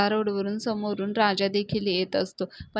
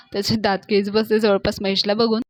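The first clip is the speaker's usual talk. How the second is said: usual speaking voice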